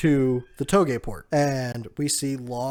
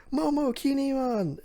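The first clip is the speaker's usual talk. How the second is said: mock group cheer at a high pitch; high calling out; 12 semitones higher than usual. Crew calls out the newcomers' names.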